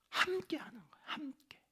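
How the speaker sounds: whispered emphasis on togetherness. Acted. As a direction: lean in and breathe the word.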